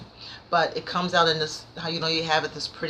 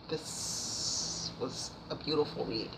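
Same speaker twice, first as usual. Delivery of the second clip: soft heartfelt praise; 4 semitones lower, 11 dB quieter, slower than usual. Real, not acted. Fond praise of a memoir.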